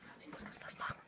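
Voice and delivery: whispering. Real, not acted. Whispering beside sleeping friend.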